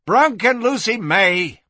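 high, drawn-out call; crying out. Acted. Madam calls back while rushing for stairs, crying out. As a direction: cry it out slowly to carry. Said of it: "Kitty cried".

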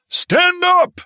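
high, loud yelled command. A narrator's reading. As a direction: yell the order down the plane. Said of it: "Willits yelled".

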